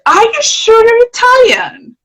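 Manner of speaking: high, slow mock-doubtful asking; high, drawn-out imitation of a doubting stranger; doubtful question. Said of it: "they ask you again".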